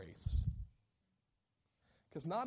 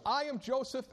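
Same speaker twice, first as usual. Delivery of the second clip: Joseph revealing himself; 12 semitones higher, 5 dB louder than usual. Acted. Preacher voices Joseph's reveal.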